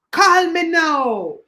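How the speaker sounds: slow Jamaican psychic hotline pitch; drawn-out "Call me now" like a TV psychic. Retold or imitated. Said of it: "You sound very much like".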